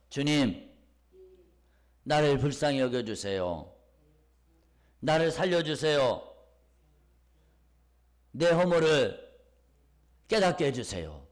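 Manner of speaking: loud, drawn-out congregational prayer; loud pleading, phrase by phrase; led prayer. Shown as a demonstration. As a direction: call out each plea for the congregation to repeat. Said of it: "우리 한번 따라합시다".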